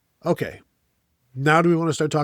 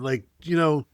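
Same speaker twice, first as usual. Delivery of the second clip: ordinary talk, nothing marked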